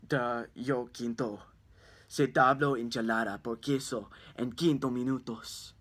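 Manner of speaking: hint of a put-on Spanish voice; mock Spanish. Strange-looking kid at the door speaking mock Spanish. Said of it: "says in Spanish".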